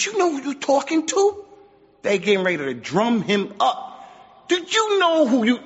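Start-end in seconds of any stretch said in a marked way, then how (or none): 0.0-1.4 agitated challenge. Retold or imitated. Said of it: "The companions got agitated". 4.5-5.6 agitated challenge. Retold or imitated. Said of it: "The companions got agitated".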